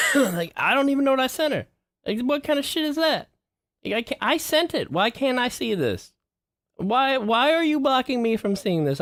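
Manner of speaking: high, fast exasperated rant. Real, not acted. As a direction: vent at the app, pitch way up. Ranting at the app.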